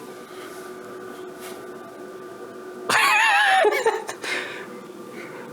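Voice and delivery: long airy giggle, pitched high; high breathless laugh. Real, not acted. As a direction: burst into a wheezing laugh. Hiccups gone, bursts out laughing.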